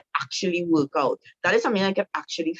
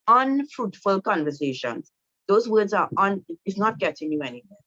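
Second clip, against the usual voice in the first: ordinary talk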